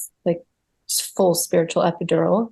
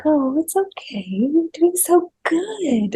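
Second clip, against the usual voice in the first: imitating a gentle midwife, high and cooing; soft, sweet reassurance; 8 semitones higher, slower than usual. Midwife soothing a woman in labour, sweet and soft. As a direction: coo it tenderly, drawing out the praise. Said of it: "she was just so soft".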